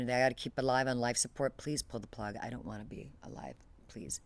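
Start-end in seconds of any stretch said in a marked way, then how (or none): 1.6-4.2 dropping to a near whisper on the last please; gentle quoted plea, trailing off; softly pleading. Retold or imitated. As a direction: repeat her sister's plea, fading away. Voicing her sister's wish.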